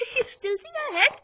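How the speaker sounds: high-pitched, laughing through the words; can't stop giggling at a kitten. Real, not acted. Giggling at her kitten's head tilt.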